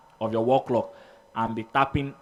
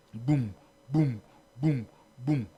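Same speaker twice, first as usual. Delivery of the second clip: evenly spaced short booms; bass drum imitation; slower than usual. Shown as a demonstration. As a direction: pulse like a bass drum on each accent. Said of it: "the bass drum that hits boom".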